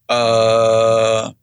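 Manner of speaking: long, level stalling sound; hesitant. Retold or imitated. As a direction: mimic being caught with no answer. Retold stunned hesitation at question.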